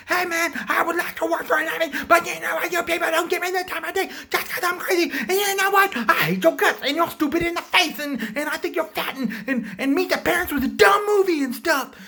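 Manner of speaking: put-on screechy, loud, childish tirade; hammed-up hysterical outburst; mock furious rant. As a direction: yell insults in a high, worked-up voice. Joker's rant peaks before shooting.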